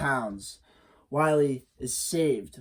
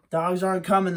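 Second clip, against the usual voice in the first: usual speaking voice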